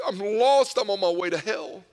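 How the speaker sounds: loud re-enacted confession of despair; anguished cry. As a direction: cry it out loudly, starting high. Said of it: "cried and wept".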